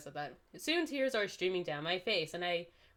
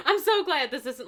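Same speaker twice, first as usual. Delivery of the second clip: high, loud, squeaky with laughter; giggling aside; laughing relief; 6 semitones higher, 9 dB louder than usual. Real, not acted. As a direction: gush it out through giggles. Laughing aside about the scene.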